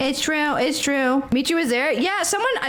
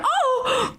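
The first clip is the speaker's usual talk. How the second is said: high-pitched "oh!" with a laugh; squealed "oh!", high and amused; startled mock squeal; 13 semitones higher than usual. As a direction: imitate her surprised little yelp. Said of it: "she went".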